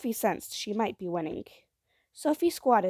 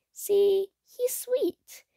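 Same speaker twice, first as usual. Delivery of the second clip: put-on high, coaxing little-girl voice; high-pitched, winsome child; pleading child; 13 semitones higher, 4 dB louder, much slower than usual. A narrator's reading.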